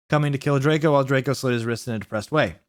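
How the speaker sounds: plain reading voice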